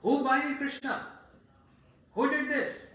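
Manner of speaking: loud, high angry shout; furious father interrogating the boys. Acted. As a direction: demand answers at full shouting volume. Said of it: "Very angry".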